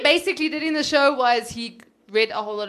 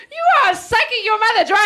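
playful mock outrage; 10 semitones higher, 7 dB louder than usual. Real, not acted.

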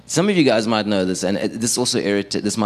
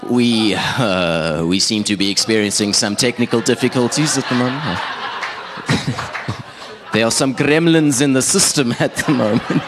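cheesy DJ stalling, climbing higher at the end; cheesy radio cover-up; 3 semitones higher than usual. Retold or imitated. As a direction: bluff through a mess-up in radio voice. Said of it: "in my cheesiest radio voice".

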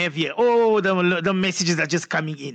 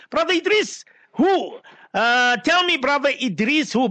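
surprised calling out; 6 semitones higher than usual. Real, not acted. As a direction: call out to him, mock-puzzled. Playfully demanding the caller's identity.

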